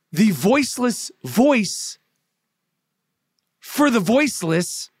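"the voiceless voice for the voiceless" slow, with long gaps after "voice"; broken-down phrase. Real, not acted. Mocking Kyrie Irving's title, slow, spaced-out "voiceless voice for the voiceless". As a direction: stretch "voiceless voice", pause, then slowly "for the voiceless". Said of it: "I'll break it down again".

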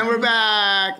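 high, loud, drawn-out announcement; show host's return. Shown as a demonstration. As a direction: call it out slow and big. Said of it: "You're gonna go".

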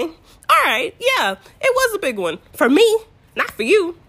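high, loud, pointed comeback; sarcastic concession. Retold or imitated. Laboring woman correcting mother-in-law about the contraction, pointedly sarcastic. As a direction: concede it with hammed-up indignation.

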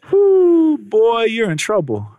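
high loud whoop, then a slow drawn-out warning; amused pastor. Retold or imitated. Pastor voiced reacting to confession.

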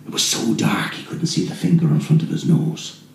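plain reading voice

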